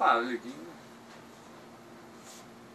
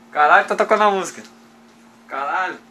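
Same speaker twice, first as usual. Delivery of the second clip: higher and louder, alarmed; startled exclamation; 6 semitones higher, 15 dB louder than usual. Real, not acted. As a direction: react to sudden music. Music suddenly starts playing. (exclamação assustada)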